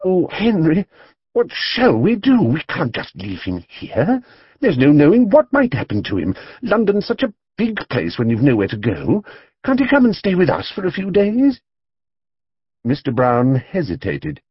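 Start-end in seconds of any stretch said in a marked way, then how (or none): 0.0-11.6 higher, pleading wife voice; appealing wife. A narrator's reading. Mrs Brown pleading with her husband to take the bear. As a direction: beg your husband to let him stay. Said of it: "turned appealingly to her husband".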